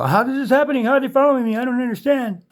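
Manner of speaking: high mock-innocent voice, parodying someone who doesn't get it; mock bewildered victim. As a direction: squeak it out like a helpless, confused person asking why. Mocks a confused stalking victim.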